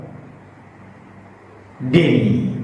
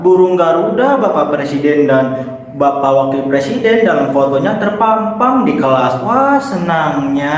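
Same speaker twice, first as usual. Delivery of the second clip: hammed-up happy exclamation; acted delight; 3 semitones higher than usual. Shown as a demonstration. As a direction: gush like a thrilled pupil. Acted delight, expression exercise.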